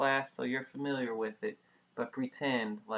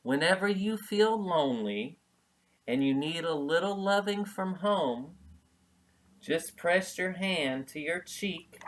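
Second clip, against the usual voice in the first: tender mother; 5 semitones higher, 4 dB louder than usual. Acted. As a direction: tell him tenderly, like a mom at parting. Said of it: "she told Chester".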